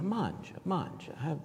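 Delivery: soft, quiet grandmother offering food; a lightly voiced gracious grandmother; gracious urging. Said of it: "there was this gracious".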